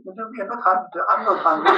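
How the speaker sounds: high, laughing refusal; laughing Thai visitors. Retold or imitated. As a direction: brush it off with a laugh.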